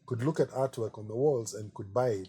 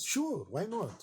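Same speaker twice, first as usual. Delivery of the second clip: high, slow, offhand shrug of a reply; a hint of an easygoing Italian boss; mock casual Ludovico; 9 semitones higher, 4 dB quieter, much slower than usual. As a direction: toss it off lightly like it costs nothing. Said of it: "And the guy goes".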